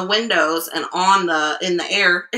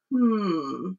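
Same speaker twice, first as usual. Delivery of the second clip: plain reading voice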